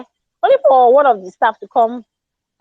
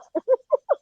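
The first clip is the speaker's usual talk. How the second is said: sudden high burst of laughter; bright laughing burst; 13 semitones higher, 5 dB quieter than usual. Real, not acted. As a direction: crack up at the memory. Laughing at her own story.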